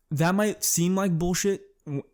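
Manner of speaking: ordinary delivery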